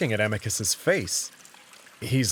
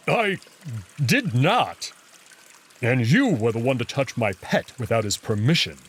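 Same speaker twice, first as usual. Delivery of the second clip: gruff wolf voice, louder and indignant; glaring retort; 3 dB louder than usual. Acted. Wolf denying the jackal's claim, angry and indignant. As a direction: snap back, offended.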